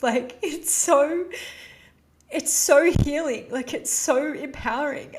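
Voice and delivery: real emotion, stretched out; teary laugh. Real, not acted. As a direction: push the words out through emotion.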